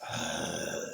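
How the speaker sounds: breathless, whispered ugh of annoyance; frustrated ugh. Retold or imitated. At the ripped barcode, a whispered frustrated ugh. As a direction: sigh it out in disgust.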